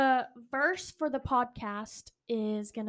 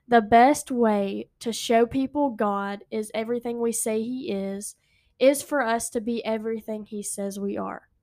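slow, deliberate reading, louder; read slowly; 8 dB louder, slower than usual. Shown as a demonstration. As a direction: read it out slow so everyone follows. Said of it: "I'm gonna read it slow".

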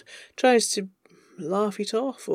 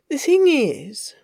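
higher, slow lead-in to a confession; nervous confession; 5 semitones higher, 4 dB louder, slower than usual. Acted. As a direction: begin slowly and higher, bracing to confess. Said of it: "he's shaking".

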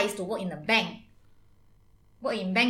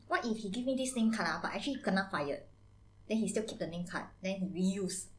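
quiet; 3 semitones lower, 10 dB quieter than usual. Acted. Auntie hushed suspicious aside.

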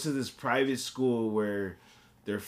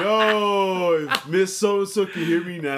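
loud, high, laughing shout; excited shout; 7 semitones higher, 6 dB louder than usual. Retold or imitated. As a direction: holler it with glee.

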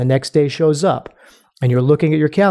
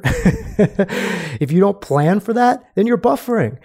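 slow words through a laugh, pitched up; 6 semitones higher, slower than usual. Real, not acted. Coach laughing at snacking off the calendar, slow laughing words. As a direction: chuckle through it slowly. Laughing while making a point.

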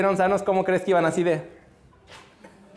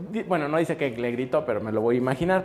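usual speaking voice

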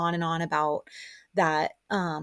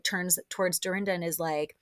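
ordinary talk, nothing marked

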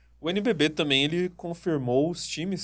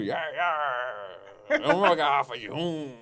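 pirate voice; slower than usual. Retold or imitated. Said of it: "Acho que é daquele jeito".

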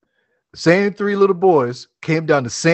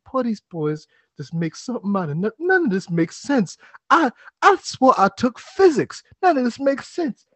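put-on baffled old man; 5 semitones higher, 4 dB quieter than usual.

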